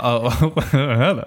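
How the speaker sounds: cheeky laughing hello. Retold or imitated. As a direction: giggle through a suggestive hello. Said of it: "She was like".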